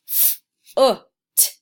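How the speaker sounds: "F-o-t" broken into sounds; sounding out letters. Shown as a demonstration. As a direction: sound out "F-o-t" piece by piece. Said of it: "I had the word foot".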